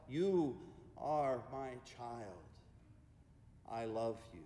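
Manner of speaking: slow God voice, sinking low and quiet. Acted. God speaks to each church member, slow and hushed at the end. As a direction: let each phrase fall gently, with pauses. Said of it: "Hear the voice of God saying".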